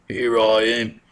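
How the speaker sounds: lightly put-on British reply; mock British accent. Prince Henry answering the teacher, light British accent. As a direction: answer as a posh-ish prince. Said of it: "I don't know why he talks like that".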